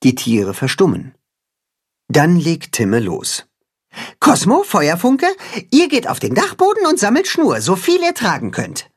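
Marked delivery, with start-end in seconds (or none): 4.2-8.9 high, brisk commanding little hen; high cat-hen voice. A narrator's reading. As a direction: give orders like a small general. Katzenhuhn gives orders.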